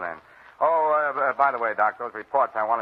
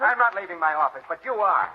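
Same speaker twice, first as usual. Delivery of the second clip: raised-pitch, exasperated order; irritated; 6 semitones higher than usual. Acted. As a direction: throw her out, fed up with the meddling. Commissioner orders interfering woman out.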